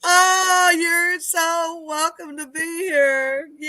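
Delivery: high, drawn-out delight; high, slow, gleeful cheer; gushing welcome. Real, not acted. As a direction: welcome them in with a happy squeal. Welcoming an excited guest on.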